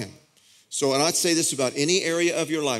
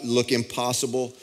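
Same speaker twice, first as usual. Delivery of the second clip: normal talking voice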